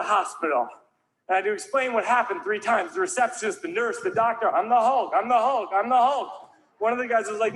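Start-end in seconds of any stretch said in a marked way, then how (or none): 4.5-6.5 raised, higher voice, comically repetitive; insistent explaining. Retold or imitated. Explaining to receptionist, nurse and doctor, loud insistence. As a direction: declare it loudly, each time the same way. Explaining himself to three hospital staff.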